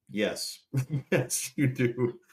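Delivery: giggling mock confirmation; laughing tease. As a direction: laugh through a teasing yes. Joking answer about her weight.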